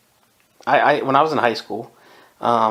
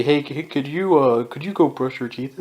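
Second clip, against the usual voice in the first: mock asking nicely; 3 semitones higher than usual. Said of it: "please is like asking nicely".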